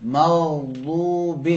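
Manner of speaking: very slow مغضوب with a gargling ghain; teacher models a gargled ghain. Shown as a demonstration. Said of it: "غرغرہ اور غرارے کی مثال ہے".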